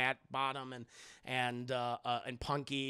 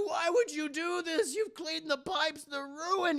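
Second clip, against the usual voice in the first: high, loud, drawn-out wail; exasperated scolding; 12 semitones higher, 5 dB louder, slower than usual. Acted. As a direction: shout in hammed-up dismay. Old man upset over ruined pipes.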